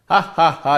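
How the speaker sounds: slow mock ha ha ha; imitated laugh. Teacher mimicking a jailer laughing at the prisoner, mock laugh. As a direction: act out his triumphant laughter, slowly. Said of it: "అని నవ్వాడు".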